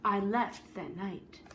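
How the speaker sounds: lively read-aloud storytelling voice; story narration. A narrator's reading. Teacher reading a picture book to the class, storyteller voice.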